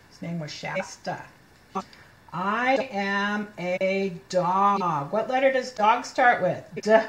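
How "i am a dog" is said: very slow, each word stretched out; slow model reading. Shown as a demonstration. Teacher reading dog page to children, very slow word-by-word. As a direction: read word by word for a beginner.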